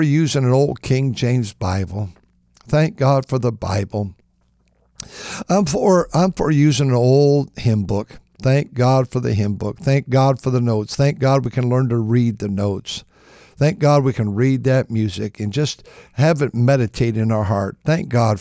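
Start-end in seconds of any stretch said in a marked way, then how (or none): none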